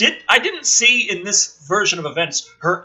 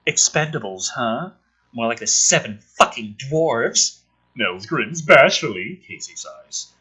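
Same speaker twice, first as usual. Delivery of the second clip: low, deadpan script read; screenplay reading; 6 semitones lower than usual. Acted. Reading the script aloud for viewers, low and deadpan. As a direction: read the scene out dry and low.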